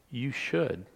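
"should" set apart by pauses; stress on SHOULD. Real, not acted. Preacher reading Jesus's instruction aloud, stress on "should". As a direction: linger on "should", then pause. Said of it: "You notice I emphasize the word should".

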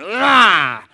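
loud gross-out roar; disgusted growl. Real, not acted. Preacher groaning in loud disgust at the popcorn ceiling.

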